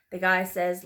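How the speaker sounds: ordinary delivery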